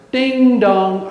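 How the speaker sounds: high, loud sung-out doorbell chime; imitated doorbell. Storyteller acts out the delivery man at the door, doorbell chime. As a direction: ring it out like a doorbell.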